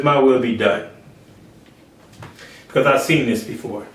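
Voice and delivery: God's grave low voice. Acted. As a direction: speak as the Father, grave and low. God the Father quoted.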